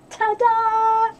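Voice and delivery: ta-da sung out high and loud; excited reveal. Real, not acted. Showing viewers the card from Kim, high and thrilled. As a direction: show off the surprise with a big high ta-da.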